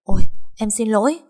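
flustered wife waking with a start; panicked apology. A narrator's reading. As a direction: blurt out sorry in alarm. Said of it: "Thục hoảng hốt".

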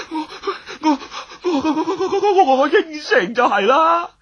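loud, high stuttering surrender; terrified giving in; panicked stammer. Acted. Terrified man gives in.

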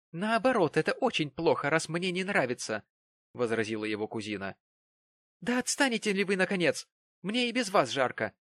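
peevish Mary. A narrator's reading. Mary peevishly tells Jonas to leave her alone. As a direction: push him away, annoyed.

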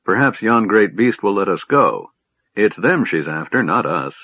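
plain reading voice

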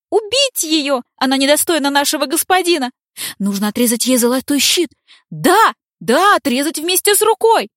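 high, shrill mob of demon women screaming; shrieking demonesses. Acted. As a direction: shriek for her blood. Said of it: "демоницы визгливо кричат на все лады". (визгливый крик демониц)

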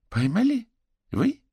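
very high, loud disbelieving question; incredulous. A narrator's reading. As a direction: ask in disbelief, pitch leaping up. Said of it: "недоверчиво посмотрела на него". (недоверчиво, высоким голосом)

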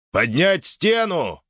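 high, drawn-out order; commanding call. A narrator's reading. Urgent command called across crowd.

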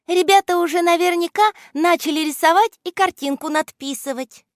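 put-on high, bright-pitched girl's voice; confident girl. A narrator's reading. Аля reassuring friends about the children, high confident voice. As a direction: speak up in a high, sure voice.

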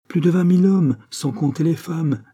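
high, loud boast easing at the end; triumphant. A narrator's reading. Pitou telling the mayor about the crowd, triumphant. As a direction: announce it proudly, pitched well up.

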